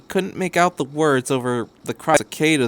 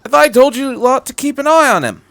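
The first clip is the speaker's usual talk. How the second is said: loud, higher scolding; annoyed; 13 semitones higher, 10 dB louder than usual. Acted. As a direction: tell them off sharply. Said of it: "The doctor sounded annoyed".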